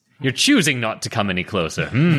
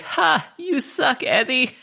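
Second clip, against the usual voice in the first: put-on childish high jeer at Eddie; mocking taunt; 12 semitones higher than usual. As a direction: tease him from the ground. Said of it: "I'm like".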